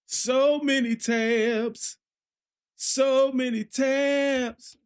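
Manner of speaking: high, stretched whine over the tabs; very slow high whine. Acted. Streamer mock-wailing about too many tabs, stretched high. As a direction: wail each word slowly and high. Drawn out complaint.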